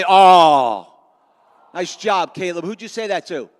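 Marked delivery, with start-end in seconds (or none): 0.0-0.9 put-on a loud drawn-out aww for the kids to copy; crowd aw. Shown as a demonstration. Speaker models a loud aww for students. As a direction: lead the room in a big aww. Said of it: "Everybody say".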